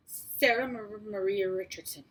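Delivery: nervous stammer over the name. A narrator's reading. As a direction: trip over Mar- and restart. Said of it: "I stammered".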